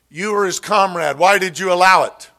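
high, loud accusing shout; heckler's yell. Retold or imitated. Heckler in the audience accusing Khrushchev, loud shout. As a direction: fling it at the stage from the crowd. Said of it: "screams, yells, or at least speaks loudly".

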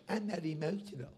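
low, very soft deadpan; mock unemotional churchgoer. Preacher mimicking members who claim no emotion, mock deadpan. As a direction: mumble it as a dull excuse.

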